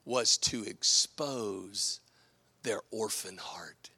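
slow hushed whisper; dramatic whisper. Acted. Preacher to congregation, whispering intimately. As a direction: drop to a breath for weight. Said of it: "drops to a whisper".

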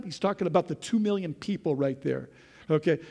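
ordinary talk, nothing marked

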